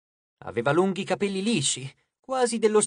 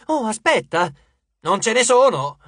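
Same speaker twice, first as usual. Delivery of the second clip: raised, loud mock realisation; sarcastic retort; 4 semitones higher, 7 dB louder than usual. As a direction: fire back with loud sarcasm. Leo's sarcastic retort in quarrel. (replica sarcastica)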